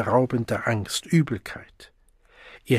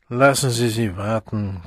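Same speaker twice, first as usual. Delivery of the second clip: muffled reply. A narrator's reading. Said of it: "kam es undeutlich zurück".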